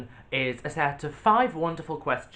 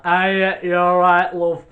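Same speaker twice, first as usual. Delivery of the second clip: loud, higher-pitched northern English greeting; northern accent; 7 semitones higher, 14 dB louder, much slower than usual. Shown as a demonstration. Host showing off UK accents on request, loud northern accent. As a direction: belt out a matey northern English hello. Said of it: "I could do a northern accent".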